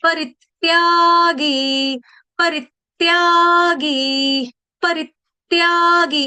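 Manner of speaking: परित्यागी slow, short री with a stress on it. Shown as a demonstration. Teacher drilling the stress on री for students, slowly. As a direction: hit the री in परित्यागी. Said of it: "आघात आना चाहिए".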